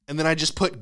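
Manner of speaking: ordinary delivery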